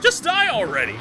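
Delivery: high, loud outburst at a stubborn enemy; fed-up gamer yelling at the screen; exasperated shout. Real, not acted. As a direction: yell it high, then let it drop. Frustrated yell at game enemy.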